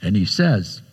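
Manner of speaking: ordinary talk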